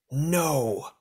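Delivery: high, sharp cry of refusal; howling no. A narrator's reading. Imprisoned Garak howls at her children, high cry. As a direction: hurl the word out as a howl. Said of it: "Garak howled".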